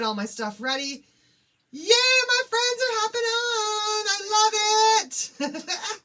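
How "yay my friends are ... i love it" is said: high, loud, drawn-out delighted squeal; half-sung giddy welcome; excited squeal. Real, not acted. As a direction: gush over friends joining the live. Excited greeting of live viewers.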